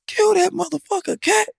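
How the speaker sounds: high, yelled egging-on. Retold or imitated. Player cheering a teammate on in a scrimmage, high yell. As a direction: hype him up to score.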